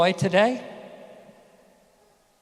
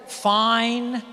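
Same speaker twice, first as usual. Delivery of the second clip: higher clipped brush-off; curt kid; 5 semitones higher than usual. Retold or imitated. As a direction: toss it off like a teenager. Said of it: "you'd go".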